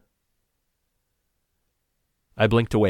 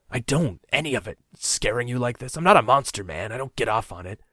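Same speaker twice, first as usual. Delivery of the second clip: raised, pleading outburst, loud on not a monster; desperate, near tears; 4 semitones higher, 5 dB louder than usual. Acted. Captor justifies himself to captive, desperate and near tears. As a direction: fight back tears while insisting. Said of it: "He sounded desperate, near tears".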